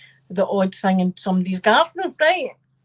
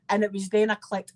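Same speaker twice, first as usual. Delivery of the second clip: ordinary talk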